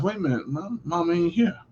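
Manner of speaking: softly, as it dawns on you you're alone; newly independent teenager. Pretend. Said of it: "first moment you realize".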